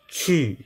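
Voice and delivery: Qu started high and dropped sharply, Mandarin fourth tone. Shown as a demonstration. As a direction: snap Qu down from a high pitch. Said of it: "will be said according to their current standard Mandarin".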